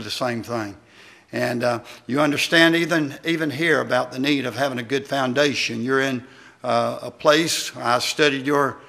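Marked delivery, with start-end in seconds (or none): none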